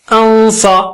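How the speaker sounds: انصا with a heavy nasal hum before ص; انصا drawn out, dark and nasal; thick nasal ghunna. Shown as a demonstration. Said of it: "نون ساکنہ بھی بہت موٹا".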